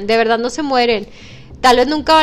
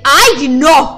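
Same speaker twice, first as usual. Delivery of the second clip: louder, lightly voiced dramatic mother; mock mom; 6 dB louder than usual. Host imitating a mother hearing the news, loud. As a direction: gasp it out like a worried mom. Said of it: "la mamá siempre así de que".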